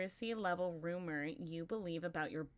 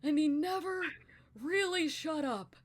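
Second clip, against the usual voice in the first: put-on breathless old storyteller, much higher; long-winded old man; 7 semitones higher, 8 dB louder than usual. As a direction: drag it out like a rambling grandpa. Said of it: "he just talked like this".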